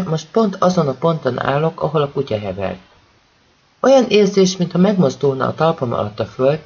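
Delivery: ordinary delivery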